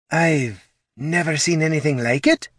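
high, airy spectral lady; tentative ghost woman; ghostly lady voice. Acted. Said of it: "ventured Genevieve".

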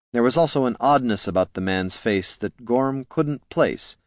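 ordinary delivery

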